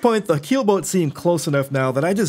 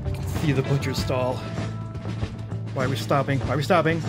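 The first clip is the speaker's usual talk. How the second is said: quiet, tense muttering; tense hushed voice; 3 semitones lower, 7 dB quieter, faster than usual. Real, not acted. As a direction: hold your breath and murmur. Tense moment near the goal.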